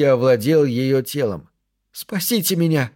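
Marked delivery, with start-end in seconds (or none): 1.9-2.9 high, desperate cry without volume; acted terrified plea; frightened plea. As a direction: beg for rescue, pitched high. Captive in terror cries for help.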